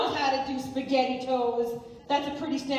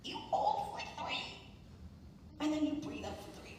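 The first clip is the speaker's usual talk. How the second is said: soft, breathing-exercise voice; demo breathing instructions; 11 dB quieter than usual. Shown as a demonstration. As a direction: guide the breath gently and softly. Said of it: "So we do three three three".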